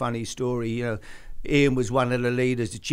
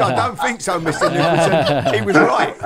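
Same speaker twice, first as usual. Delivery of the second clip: louder, words broken by laughter; laughing; 9 dB louder than usual. Real, not acted. Recalling his reply to Bill Nicholson, laughing loudly. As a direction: answer while laughing out loud.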